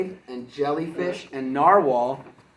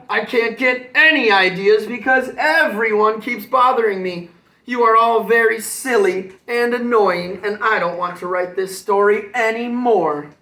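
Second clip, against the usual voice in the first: higher and louder, exasperated; angry rant; 6 semitones higher, 7 dB louder than usual. Acted. Unicorn scolding annoying friends, loud and fed up. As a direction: let all the frustration out.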